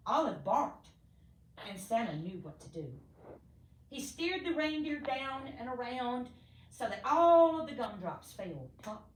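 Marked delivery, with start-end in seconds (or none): none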